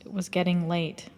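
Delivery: usual speaking voice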